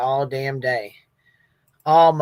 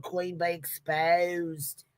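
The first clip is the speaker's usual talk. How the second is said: mock British accent; 7 dB quieter, much slower than usual. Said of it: "do you guys love my accent there".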